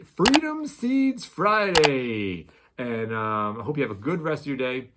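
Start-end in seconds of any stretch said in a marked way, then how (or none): 0.0-2.5 long, high, loud announcer call; belted, drawn-out sign-off; hyped show-name shout. Pretend. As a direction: stretch out each word like a big announcer.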